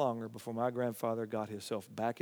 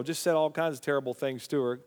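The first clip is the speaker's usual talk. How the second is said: ordinary talk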